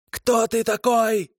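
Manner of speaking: high, slow shrieking hag; kikimora voice. A narrator's reading. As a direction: drag it out high like a frightened water witch. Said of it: "взвизгнула Тамара".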